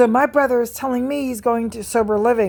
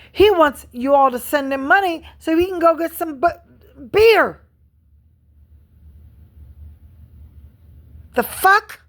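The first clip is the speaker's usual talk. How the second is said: angry outburst; 5 semitones higher than usual. Real, not acted. Ranting to viewers about her brother's drinking, exploding in disbelief. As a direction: blurt it out, furious and incredulous.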